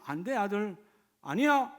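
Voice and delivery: a hint of a stern father saying no; lightly voiced father refusing his son; mock refusing father. Preacher voicing a father who never refused, mock stern. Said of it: "하고 반대한 적이 없었어요".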